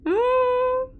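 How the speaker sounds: mock ghostly ooh, way up high; a playful eerie wail; high spooky ooh. Real, not acted. Reacting to creepy scene.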